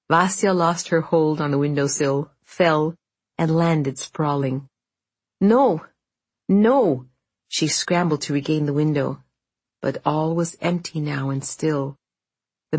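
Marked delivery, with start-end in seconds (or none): 5.4-7.1 high, loud anguished shout; desperate cry. Acted. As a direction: cry out in despair. Vasya falls, desperate cry.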